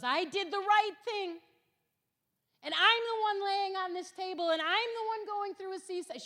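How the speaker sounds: put-on high, loud, wounded protest in a young wife's voice; young wife pouring out her anger at the table; retold angry outburst. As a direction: cry out the unfairness, pitched high and loud. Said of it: "She was so angry".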